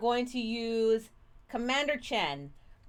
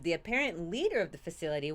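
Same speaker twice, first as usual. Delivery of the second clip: usual speaking voice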